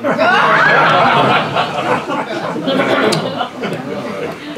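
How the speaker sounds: a real, drawn-out chuckle at the absurdity; long amused laugh. Real, not acted. Historian laughing at the misnamed Aussie, long amused chuckle. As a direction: let a long laugh roll out.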